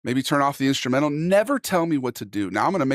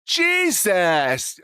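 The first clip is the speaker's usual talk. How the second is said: a hint of an exasperated mom, pitched a little higher; imitating mom; 6 semitones higher, much slower than usual. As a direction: lightly sigh out his name like a fed-up mother. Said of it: "My mom said".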